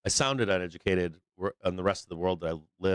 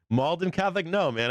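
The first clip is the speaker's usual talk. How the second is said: surprised scoff; 9 semitones higher than usual. Real, not acted. Reacting to chat guessing his school.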